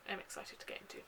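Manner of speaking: a soft whispered aside. Real, not acted. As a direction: breathe it out as a little secret to the viewers. Quietly excited aside to viewers.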